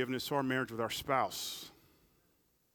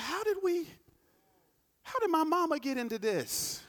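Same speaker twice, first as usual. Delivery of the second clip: mock exasperated spouse; 7 dB louder than usual. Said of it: "you're like".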